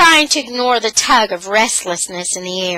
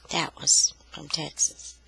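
whisper; 7 semitones lower, 8 dB quieter than usual. Real, not acted. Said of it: "whispered aside about accent".

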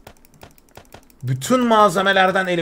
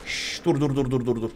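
hushing softly; 7 semitones lower, 10 dB quieter than usual. Real, not acted. Trying to steady the helicopter.

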